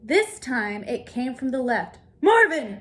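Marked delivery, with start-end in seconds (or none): 2.2-2.8 high, loud yell of the name; yelling Marvin's name. Acted. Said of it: "Mister Zonker yelled".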